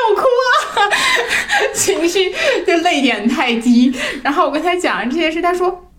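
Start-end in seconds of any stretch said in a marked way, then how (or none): 0.1-3.9 high, louder words through laughter; laughing. Real, not acted. To the hosts about crying easily, laughing high. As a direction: laugh at yourself while telling it.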